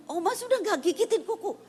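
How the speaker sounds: very high imitation of a small child; pleading little child. Grandchild tells Oma the nail-biting has stopped, high childish plea. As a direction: pipe up high like an eager toddler.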